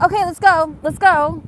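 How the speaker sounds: a hammed-up impatient Dimitri; hurried mock Dimitri. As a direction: urge everyone out the door, eager. Dimitri hurrying them out.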